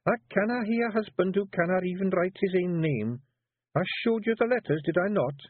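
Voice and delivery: high Scottish Feegle woman coaxing; wife coaxing her husband into writing. A narrator's reading. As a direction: push your husband gently, high and Scots. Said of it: "said Jeanie".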